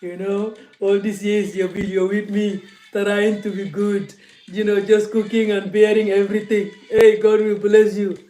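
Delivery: loud, preachy husband praising his long-suffering wife; booming sanctimonious husband thanking his wife; mock pious husband. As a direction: ham up a self-satisfied husband blessing his wife. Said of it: "You're like".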